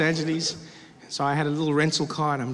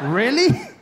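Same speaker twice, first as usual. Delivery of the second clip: high, loud incredulous question; mock disbelief; 8 semitones higher, 5 dB louder than usual. Traveller reading airport sign, incredulous. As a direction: query it in rising disbelief.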